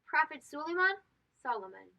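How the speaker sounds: ordinary delivery, nothing marked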